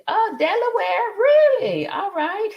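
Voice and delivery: high-pitched, drawn-out surprised exclamation; pleasantly astonished, ending in a laugh; real surprise. Real, not acted. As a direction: react with delighted disbelief, voice shooting up. Reacts to a commenter's location.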